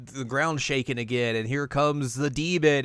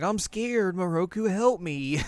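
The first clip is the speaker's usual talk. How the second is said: much higher, damsel-in-distress whimper; mock scared plea; 8 semitones higher, slower than usual. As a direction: ham up the helpless fright. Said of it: "the Shima's like".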